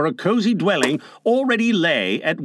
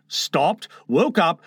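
ordinary delivery, nothing marked

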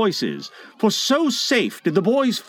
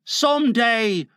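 higher-pitched henchman; a pleading question in a raised character voice; pirate Smee; 7 semitones higher than usual. A narrator's reading. Smee offers his cutlass to Hook, high henchman voice.

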